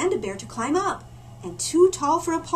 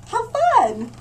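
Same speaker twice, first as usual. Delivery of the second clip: higher and louder, thrilled; delighted exclaim; 11 semitones higher, 8 dB louder than usual. A narrator's reading. Narrator to child, thrilled and much higher. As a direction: sell the joy to the little listener.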